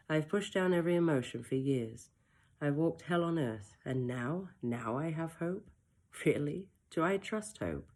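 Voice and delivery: ordinary delivery, nothing marked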